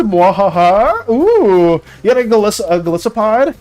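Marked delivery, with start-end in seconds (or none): 0.0-1.1 mad scientist laugh. Pretend. Gloating over an obtained Pokemon.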